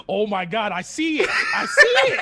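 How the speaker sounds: rising to a high, loud squeal; excited discovery. Retold or imitated. Cox spotting the tattoo on the Patreon page, rising excited squeal. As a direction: build from shock to a squealing I see it.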